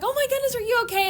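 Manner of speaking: high, concerned fussing voice; fussing adult. Retold or imitated. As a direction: fuss over a hurt child. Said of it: "It wasn't one of those".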